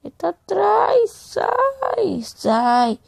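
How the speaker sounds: high panicked cry; being chased. Real, not acted. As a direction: squeal in panic as he closes in. (voz aguda de pânico)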